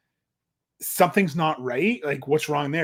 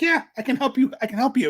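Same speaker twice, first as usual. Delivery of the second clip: eager helper; 9 semitones higher, faster than usual. Retold or imitated. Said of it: "And R J City's like".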